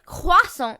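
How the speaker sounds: croissant as kwah-SAH, high; French accent. Shown as a demonstration. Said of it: "Say it, say it, say it".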